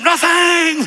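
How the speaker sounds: very high, loud defiant declaration; shouted with attitude. Shown as a demonstration. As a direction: belt it out with full attitude. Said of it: "Say it with attitude".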